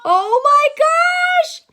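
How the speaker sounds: high, loud, slow oh my gosh; mock shock. Mother acting shocked for her toddler, high and slow. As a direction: gasp it big for the child.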